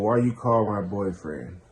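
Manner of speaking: ordinary talk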